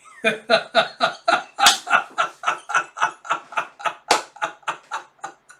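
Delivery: breathless unvoiced laughing fit; can't stop laughing; long wheezing laugh. Real, not acted. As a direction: lose it completely, silent wheeze. Narrator cracks up.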